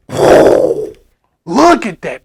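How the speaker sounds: drawn out slow in wonder; a slow, amazed look at that; awed. Acted. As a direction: marvel at it slowly. Marvelling at the flying truck.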